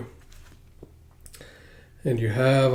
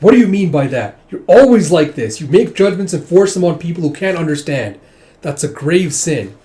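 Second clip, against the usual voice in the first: raised, much louder rebuke; angry scolding; 5 semitones higher, 7 dB louder than usual. Retold or imitated. Mother scolding her son in rising anger. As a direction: scold him hotly as a mother.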